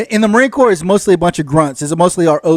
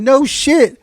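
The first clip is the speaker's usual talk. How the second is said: exasperated; 6 semitones higher, slower than usual. Retold or imitated. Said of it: "I said".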